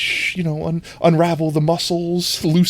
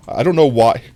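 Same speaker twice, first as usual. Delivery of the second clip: ordinary talk, nothing marked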